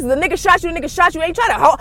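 ordinary talk

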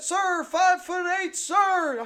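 high, loud, slow recruit shouting back; recruit reply. Retold or imitated. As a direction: bellow it like a terrified recruit. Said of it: "drill sergeants".

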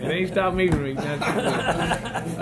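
chuckling through the words; laughing. Real, not acted. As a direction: laugh while admitting it. Admits eating while laughing.

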